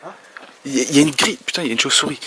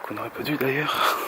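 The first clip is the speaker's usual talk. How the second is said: ordinary talk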